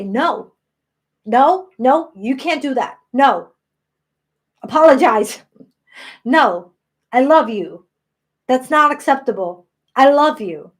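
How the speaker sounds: loud, emphatic telling-off to oneself; stern self-correction. Retold or imitated. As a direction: cut off the self-criticism, loudly and firmly. Acts out scolding her own self-talk.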